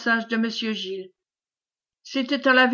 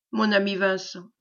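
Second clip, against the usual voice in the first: usual speaking voice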